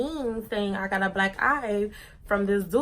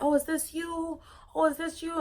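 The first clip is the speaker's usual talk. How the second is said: higher, teasing classmates' voice; mocking classmates; 7 semitones higher, 3 dB quieter than usual. Classmates at school teasing her about the post, higher voice. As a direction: tease her like gossiping kids. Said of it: "people were like".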